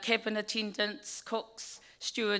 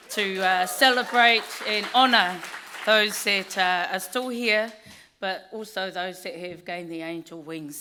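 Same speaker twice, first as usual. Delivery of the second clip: normal talking voice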